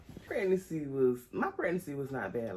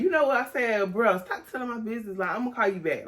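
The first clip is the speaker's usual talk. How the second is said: heated; 6 semitones higher, 5 dB louder, faster than usual. Retold or imitated. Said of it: "I just got heated just that fast".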